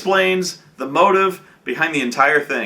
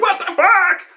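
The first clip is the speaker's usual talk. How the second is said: high, loud mock cry with a laugh; panicked disbelief; stunned cops; 4 dB louder than usual. Cops reacting to grenades, mock shocked shout.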